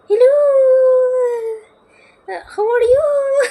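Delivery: very high, loud, slow sing-song hello; imitating a viral lady. Imitating a viral TikTok lady's sugary, very high greeting. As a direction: squeal it like an over-sweet influencer. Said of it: "कैसे बोलती थी वो".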